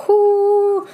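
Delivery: high, loud gleeful whoo; excited whoo. Real, not acted. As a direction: let out a thrilled whoo. Excited outburst about manifestations.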